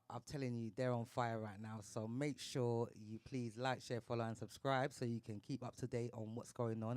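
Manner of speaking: usual speaking voice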